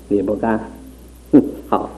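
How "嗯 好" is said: ordinary talk, nothing marked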